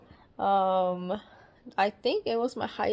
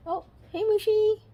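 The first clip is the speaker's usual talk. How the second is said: cooing to a pet; 11 semitones higher than usual. Real, not acted. Greeting the cat.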